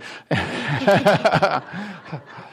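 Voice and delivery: a long laugh that falls away; real laugh. Real, not acted. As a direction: laugh freely at the joke. Laughs after the Nevada joke.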